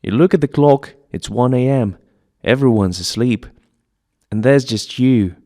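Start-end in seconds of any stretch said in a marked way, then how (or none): none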